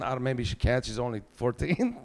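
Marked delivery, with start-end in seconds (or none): none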